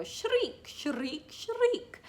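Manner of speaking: "Shriek" with a trilled rolled R, squealed very high. Shown as a demonstration. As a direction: say "Shriek" rolling the R, high like a peacock. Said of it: "and I roll my R's".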